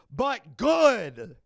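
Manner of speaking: high, loud burst on GOOD; emphatic shout. Real, not acted. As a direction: belt it out, stretching the last word. Repeats it as a shout.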